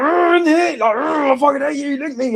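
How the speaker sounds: high, loud mock rage with a growl; mock angry fan. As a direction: ham up a raging online fan. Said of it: "people get mad like".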